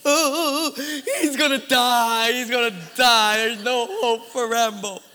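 loud, hammed-up wailing; hysterical crying. Husband imitates his wife fearing for Rambo, mock hysterical crying. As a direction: bawl the words in mock despair. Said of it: "My wife starts hysterically crying".

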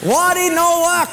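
high, loud and slow mock Japanese accent. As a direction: belt the punchline as the sushi owner with a mock Japanese accent. Punchline in the sushi owner's voice.

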